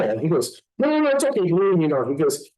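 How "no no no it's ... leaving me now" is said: high-pitched mock protest; high, hurried brush-off; flustered refusal. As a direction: imitate a catcher hurriedly waving someone off. Catcher refusing to hand over ball.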